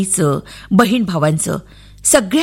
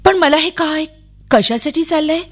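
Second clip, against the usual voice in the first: higher, puzzled voice; bewildered child; 6 semitones higher than usual. A narrator's reading. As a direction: question it in mild bewilderment. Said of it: "काहीच समजत नव्हतं". (गोंधळून, उंच आवाजात)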